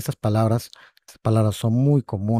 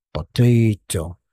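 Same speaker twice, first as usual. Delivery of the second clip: "Potato" drawn out slowly, falling; slow model word; 5 dB louder, much slower than usual. Shown as a demonstration. Teacher repeating "Potato" slowly so students catch it. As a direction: stretch every syllable of "Potato". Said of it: "teacher repeats slowly".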